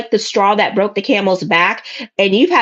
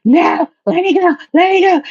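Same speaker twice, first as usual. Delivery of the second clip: high-pitched mock struggle to break free; riled-up client; 8 semitones higher than usual. Client fights to get away from returned ex, mock frantic. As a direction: imitate someone straining to be let loose. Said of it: "like Rottweilers when it comes to that".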